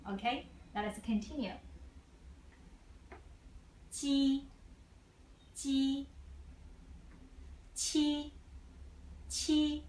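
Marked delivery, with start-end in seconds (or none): 3.9-9.8 "Ji, qi" held high and level; first tone. Shown as a demonstration. Teacher models syllables for learners to follow, flat first tone. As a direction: sing out "Ji, qi" on one flat high pitch. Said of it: "first tone is always flat".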